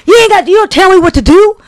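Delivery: high, loud imitation of an indignant woman; yelling lady. Imitating the lady refusing to calm down, yelling. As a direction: holler back at being told to calm down. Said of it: "They're telling her to calm".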